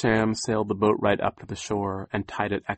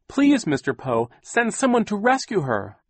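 high, loud, urgent plea; crying out; 12 semitones higher, 8 dB louder than usual. A narrator's reading. Violet begs Poe to send help, crying out. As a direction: implore him to act now. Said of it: "Violet cried".

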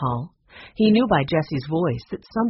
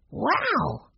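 high, falling wow; little boy marvelling to himself; high-pitched child's wonder; boy's amazed thought; 9 semitones higher than usual. A narrator's reading. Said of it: "thought Benny".

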